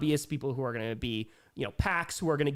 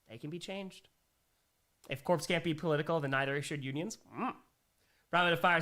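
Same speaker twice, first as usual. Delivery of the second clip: ordinary delivery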